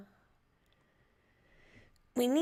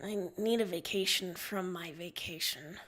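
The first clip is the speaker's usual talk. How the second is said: lower, softer mumble to self; 5 semitones lower, 6 dB quieter than usual. Acted. Tired captain walking to the ship, mumbling. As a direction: mutter it under your breath. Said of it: "You mumble to yourself".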